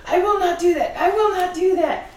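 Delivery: put-on high, loud English accent, firm refusal. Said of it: "all of this is in an English accent".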